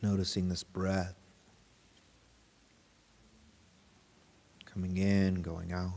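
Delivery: guided meditation. Real, not acted. Teacher guiding a group to notice body and breath, guided meditation. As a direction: lead them gently inward, unhurried and even.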